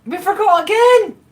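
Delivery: slow, high, loud exclamation; high shout. Real, not acted. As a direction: cry out in high dismay. Forgot ingredient again.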